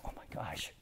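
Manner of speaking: breathless whispered oh my gosh; hushed awe; whispered gasp. Retold or imitated. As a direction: gasp it under your breath, moved. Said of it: "I started to tear up".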